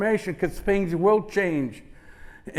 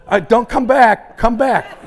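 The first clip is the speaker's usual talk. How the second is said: loud, raised, playful call after someone; joking call-out; 4 semitones higher, 7 dB louder, slower than usual. Pretend. Speaker to a listener leaving the speech, joking call-out. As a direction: call them back in jest. Calls after audience member walking out.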